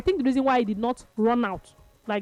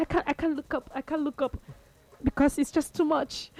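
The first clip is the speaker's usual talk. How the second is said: soft, higher, imitated overwhelmed voice; choked up; 4 semitones higher, 4 dB quieter than usual. Retelling the coach's interview, imitated choked-up voice. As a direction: softly plead that it is too much. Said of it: "He put his head down".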